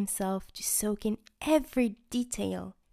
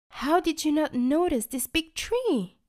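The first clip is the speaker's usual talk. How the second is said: high, curious toothbrush; Chubby voice; 8 semitones higher, 5 dB louder than usual. Acted. Chubby asking the candy girl about the tree. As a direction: ask with wide-eyed curiosity.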